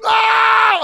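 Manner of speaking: high, loud mock cry of pain; retold pain yelp. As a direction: yelp like the injured player.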